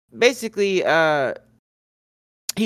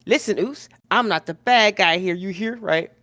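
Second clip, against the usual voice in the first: higher-pitched imitated wrestler's challenge; confrontational challenge; 4 semitones higher, faster than usual. Jimmy chimes in against Sami, confrontational. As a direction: retell it as an indignant, questioning comeback. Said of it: "Jimmy chimes in and he goes".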